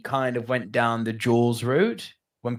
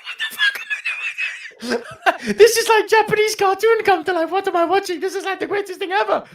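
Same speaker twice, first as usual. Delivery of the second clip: much higher, laughing through each word; gleeful giggling awe; laughing disbelief; 4 dB louder than usual. Real, not acted. Raving about the Godzilla ending, laughing.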